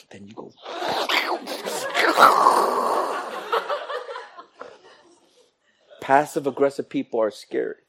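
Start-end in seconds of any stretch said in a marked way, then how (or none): 0.6-4.6 a high breathless evil giggle. Retold or imitated. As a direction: silent wicked wheeze of a laugh. After sword gesture.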